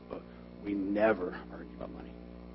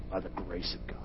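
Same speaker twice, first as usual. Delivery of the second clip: whispered; 3 semitones lower, 6 dB quieter than usual. Acted. Preacher whispering to the congregation before repeating it aloud.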